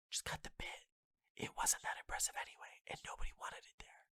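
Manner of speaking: unvoiced whispered grumble; whispered aside. Real, not acted. Vlogger whispering a snide aside to viewers. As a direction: whisper it like a private gripe. Muttered aside about cutting the bit.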